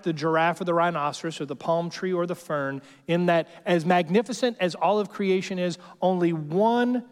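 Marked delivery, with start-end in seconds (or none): 6.0-7.0 "only one" stretched slowly; slow emphasis. Real, not acted. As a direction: draw out "only one" for weight. Preacher slows for emphasis.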